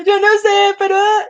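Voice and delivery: voz llorosa, muy aguda y exagerada; llanto fingido. Acted. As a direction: finge llorar sin saber por qué, en tono muy alto. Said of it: "por qué está llorando". (lloriqueo exagerado y agudo)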